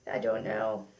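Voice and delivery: goofy low voice. Pretend. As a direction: drop into a silly low voice. Goofy aside, forgot product name.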